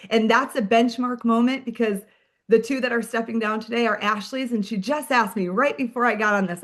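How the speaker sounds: ordinary talk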